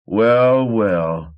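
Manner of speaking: drawn-out gloating well, well; gloating over the body; smug drawl. A narrator's reading. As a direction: savour the kill, smug. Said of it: "he repeated".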